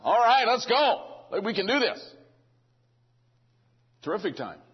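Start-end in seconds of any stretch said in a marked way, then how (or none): 0.0-2.1 loud, high whoop of eagerness; exaggerated pep-rally rallying cry; hammed-up cheering. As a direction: put on a prophet raring to go, drawn out and loud. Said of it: "he doesn't talk like that".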